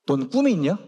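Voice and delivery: a hint of a low-voiced father; father's reproach. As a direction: lightly imitate dad's pointed question, pitched low. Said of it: "저희 아버지 한마디 하세요".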